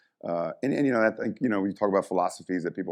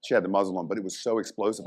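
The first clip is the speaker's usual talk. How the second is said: usual speaking voice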